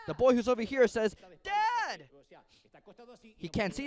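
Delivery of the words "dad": put-on high child's voice calling for his dad; boy calling out. Bound son calling to his father, high child's call. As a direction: call out as a small boy who can't see his father.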